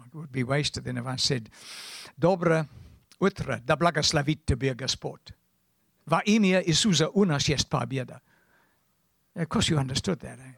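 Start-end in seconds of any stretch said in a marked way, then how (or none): 2.2-8.2 speaking Russian. Shown as a demonstration. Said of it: "This is real dialect".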